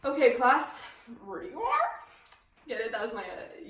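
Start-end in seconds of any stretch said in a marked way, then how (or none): 1.2-1.9 high-pitched creature impersonation, rising; Yoda. Shown as a demonstration. Teacher joking to the class about their exam, in a Yoda voice. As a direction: do a goofy high little alien master voice. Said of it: "Yoda impersonation".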